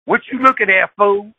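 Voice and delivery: high loud hothead picking a fight; hothead boyfriend. Retold or imitated. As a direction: bark it at a stranger. Boyfriend challenging a bystander.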